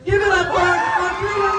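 loud hype intro; emcee announcement. Acted. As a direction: announce her with big energy. Host introducing next queen.

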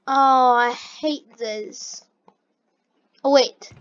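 ordinary talk, nothing marked

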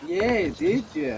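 put-on high, slow drawn-out Australian accent; mock Australian accent. Pressing a crewmate in a hammed-up Australian accent. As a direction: stretch it out like a cartoon Aussie, Australian accent. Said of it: "you look like an Australian".

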